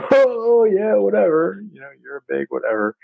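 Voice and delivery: taunting schoolyard bully shrugging off a threat; mocking brush-off. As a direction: scoff at the warning like a smug bully. Bully mocks the son's warning.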